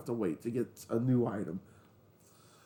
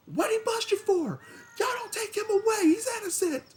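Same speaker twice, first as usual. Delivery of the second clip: friends yelling. Retold or imitated. Friends yelling out the car window, high hollering. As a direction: holler it like rowdy friends defending me. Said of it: "They're being complete degenerates".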